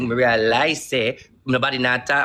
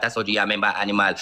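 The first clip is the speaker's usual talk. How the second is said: ordinary talk, nothing marked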